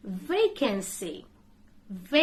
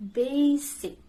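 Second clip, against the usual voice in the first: ordinary delivery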